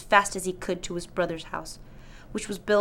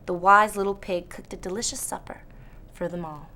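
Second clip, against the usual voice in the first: usual speaking voice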